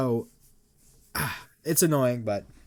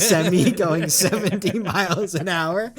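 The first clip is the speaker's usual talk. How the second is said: laughing while talking; 5 semitones higher, 5 dB louder than usual. Real, not acted. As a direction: giggle through the words. Laughing at a silly story.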